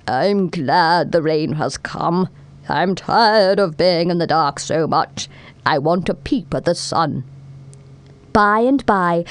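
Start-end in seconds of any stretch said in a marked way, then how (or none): 0.1-7.3 Mister Worm's voice. A narrator's reading. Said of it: "and heard him say".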